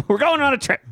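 high, hammed-up glee; over-eager excitement. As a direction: act thrilled like a dog off to the car. Acting out dog-like eagerness.

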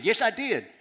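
loud, high insistent comeback; arguing back. Retold or imitated. Bob contradicting the doubting professor, arguing back. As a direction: raise pitch and volume to insist. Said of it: "they're getting in an argument".

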